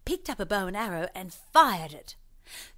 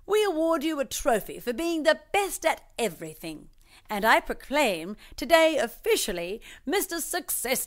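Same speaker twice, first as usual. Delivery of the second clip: lightly voiced pompous mayor, higher and a bit louder; mayor's speech; 7 semitones higher, 4 dB louder than usual. Mayor awarding the trophy, lightly pompous proclamation. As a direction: proclaim it like a small-town mayor. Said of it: "said the mayor".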